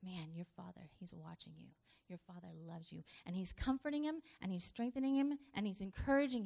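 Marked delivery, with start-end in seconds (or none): 0.0-3.0 soft, gentle reminder; tender reassurance. Retold or imitated. Angel comforting Jesus in the garden, softly reassuring. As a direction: comfort him quietly. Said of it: "reminding him".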